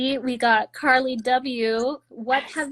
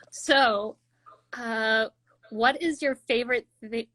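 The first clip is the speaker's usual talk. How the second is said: ordinary talk, nothing marked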